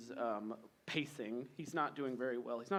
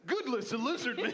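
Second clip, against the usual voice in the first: mock surprise; 9 semitones higher, 5 dB louder than usual. King sees Drax.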